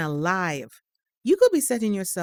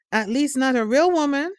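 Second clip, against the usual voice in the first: mother's voice jumps high and loud; rising scold; 7 semitones higher, 5 dB louder than usual. Acted. Mother warning her teenage son, raised and sharp. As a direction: push the point with a sharp raised jab.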